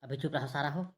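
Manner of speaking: hushing his buddy near the police; hushed. Acted. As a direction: drop the voice to hush a friend. Friends hushing before the police.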